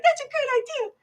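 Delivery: squeaky high imitation; high mock voice. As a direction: pitch way up, light and bright. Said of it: "she's like".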